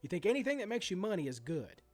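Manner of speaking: ordinary talk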